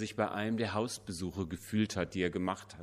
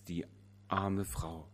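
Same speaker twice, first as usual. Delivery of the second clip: soft, slow, heavy with pity; pitying murmur; 3 semitones lower, 7 dB quieter, slower than usual. A narrator's reading. Preacher sighs over the widow, soft and slow. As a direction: let it sink, slow and soft.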